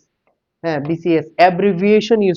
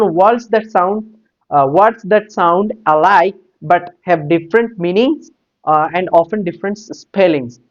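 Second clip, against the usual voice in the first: slow dictation; much slower than usual. Shown as a demonstration. Reads English definition aloud.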